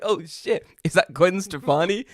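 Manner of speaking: high, loud, starstruck gasp of a line; mock surprise. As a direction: imitate a stranger's excited double take. Mimicking man's mistaken recognition.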